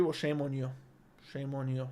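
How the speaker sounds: a soft teasing shame on you; mock scolding. Real, not acted. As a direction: quietly tease the chemistry failure.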